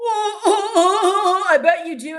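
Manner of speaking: sarcastic laughing jab; mocking laugh. Real, not acted. Mocking a chatter's vitamin boast.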